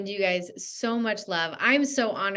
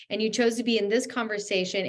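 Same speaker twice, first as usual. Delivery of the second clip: ordinary talk, nothing marked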